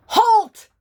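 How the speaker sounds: sharp loud shout of Halt; soldiers' shout. Acted. Toy soldiers shouting at the swaying cat, loud. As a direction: bark the order like a toy soldier. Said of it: "the soldiers shouted".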